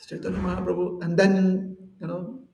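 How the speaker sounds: normal talking voice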